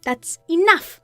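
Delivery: angry wife. Acted. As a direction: cut him off and confront him. Wife confronts cheating husband.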